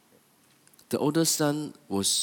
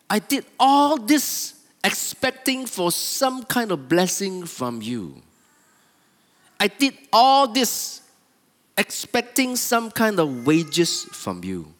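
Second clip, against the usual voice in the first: slow, raised-voice grievance; angry older son; 8 semitones higher, 4 dB louder, slower than usual. Acted. Older son expecting wages from his father, angrily aggrieved. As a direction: protest slowly, hitting each opening high. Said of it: "He was so angry".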